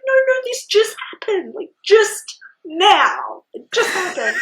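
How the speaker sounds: high, loud, pleading protest; frantic mock insistence. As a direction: wail it out, dragging out "just now". Acting frantic answer mid-labour.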